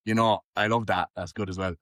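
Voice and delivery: normal talking voice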